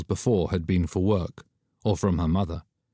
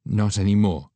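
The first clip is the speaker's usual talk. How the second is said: ordinary delivery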